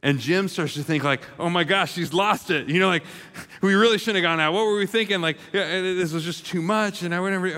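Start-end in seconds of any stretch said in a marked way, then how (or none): 1.4-7.0 panicked thoughts. Retold or imitated. Jim panics at the bathroom wall.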